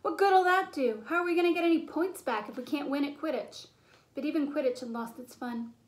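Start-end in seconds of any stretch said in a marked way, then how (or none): 0.0-3.7 higher, louder angry shouting; furious team captain yelling; thundering on. A narrator's reading. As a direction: shout down the idea. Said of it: "Wood thundered".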